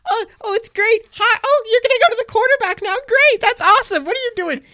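high, fast mocking voice of a clueless lineman; mocking Nate Solder. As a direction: squeak it out like a player who can't believe the play. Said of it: "doing his Nate Solder impression".

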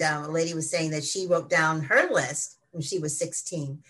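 ordinary talk, nothing marked

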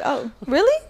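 mock surprise. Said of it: "I was like".

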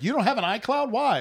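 high-pitched retold disbelief; imitated incredulous question. Co-host quoting her reaction three days ago, high mock incredulity. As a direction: pitch it well up, mimicking someone baffled.

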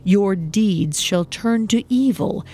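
plain reading voice

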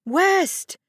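high calling-out. A narrator's reading. Woman joining the mother's search, calling frantically. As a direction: call the child's name, high and urgent. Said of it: "raised her voice to join the frantic mothers".

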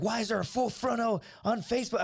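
mildly mocked outrage; retold angry girlfriend. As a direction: mimic a girlfriend demanding an answer. Girlfriend confronts him on phone.

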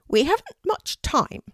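high, loud bird voice; an urgent talking raven; unusual raven voice. A narrator's reading. Raven Harris urges.

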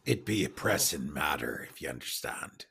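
low, soft pirate captain; pirate in character. Acted. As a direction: speak low, as if confiding to the watchman. Player speaks in character as Ezra.

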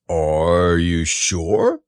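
slow, low gloomy donkey; Eeyore voice. Acted. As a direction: drag it out mournfully. Eeyore speaking.

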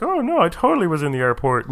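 high, sly villain voice; bad guy voice. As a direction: play it like a scheming bad guy. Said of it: "That's your voice".

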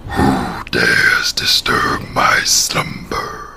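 very low, very slow whisper; a booming cave guardian, whispered slowly; scary cave voice. Acted. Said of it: "Say the thing Addie likes that you say".

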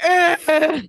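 high overwhelmed ugh with a laugh; emotional groan. Retold or imitated. S0 reacting to Clayton's words, high laughing ugh. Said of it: "we were like".

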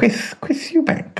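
high, slow lisping voice; Chris Eubank impression. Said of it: "Yes, he'd be like that".

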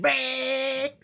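a sudden high "Bang!" sound effect; mock crash. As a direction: pop it out like an impact.

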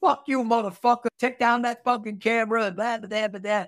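high, loud mocking tirade; imitated angry gangster. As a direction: hurl it as a high-pitched retold taunt, fading into blahs. Retelling gang's hostile replies.

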